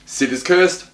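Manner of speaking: ordinary talk, nothing marked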